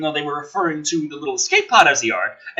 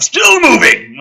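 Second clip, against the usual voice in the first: much higher, loud mock horror cry; hammed-up shout; 14 semitones higher, 10 dB louder, slower than usual. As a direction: yell it like a B-movie scream. Dramatic horror beat, shouting.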